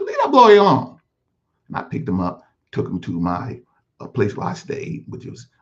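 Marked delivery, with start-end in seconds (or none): 0.0-1.0 loud sudden yell to break up a beating; shout at attackers. Retold or imitated. Street hustler shouts at the men beating the boy, loud. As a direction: bark it out to stop the fight.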